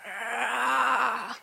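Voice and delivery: low, soft, growled "Rah" from outside; lightly voiced possum noise; mock demonic growl. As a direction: imitate an eerie answering growl through the window. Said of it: "And I went, "Oh".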